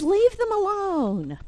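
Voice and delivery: high, drawn-out storybook plea; advising Frog. Acted. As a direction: start high and glide down as you urge. Frog advises Toad about seeds.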